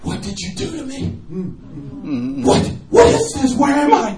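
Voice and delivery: high, startled cries of wonder; mock amazed man asking where he is; bewildered. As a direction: gasp out the questions like waking up. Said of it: "he goes".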